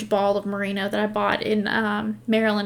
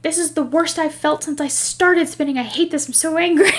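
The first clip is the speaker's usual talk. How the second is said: retold meltdown; 6 semitones higher, 5 dB louder than usual. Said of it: "And I was like".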